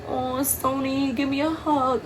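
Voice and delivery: high, drawn-out pitying coo; mock sympathizer. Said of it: "I hate people from, like".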